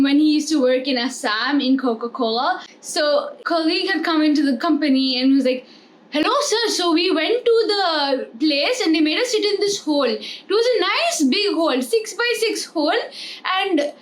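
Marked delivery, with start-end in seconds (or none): 6.1-13.9 mock Gujarati accent, hole for hall. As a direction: imitate a Gujarati colleague, case for cash. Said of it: "he had a Gujarati accent".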